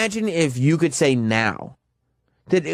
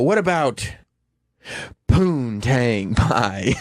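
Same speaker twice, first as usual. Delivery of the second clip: mock The Rock line; much slower than usual. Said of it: "The Rock would go".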